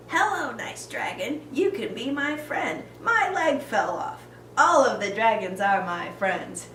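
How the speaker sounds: Hiccup. Acted. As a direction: pitch it up and poke fun at him. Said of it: "Do your stupid hiccup impression".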